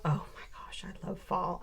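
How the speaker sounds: soft, unvoiced whisper of delight; hushed delight. Stepping out into autumn air, hushed whispered delight. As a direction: whisper it like a happy secret. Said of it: "I walk out the door and I actually go".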